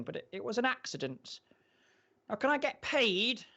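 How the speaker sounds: high, halting nervous character voice; high-pitched and hesitant, dragging through the explanation; hesitant house owner. Acted. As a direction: play a timid owner stumbling over his words. Plays hesitant skinny house owner.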